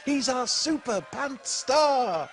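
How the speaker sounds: high excited cheer. Acted. Narrator cheering for the hero, high and excited. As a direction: cheer for the hero at full pitch.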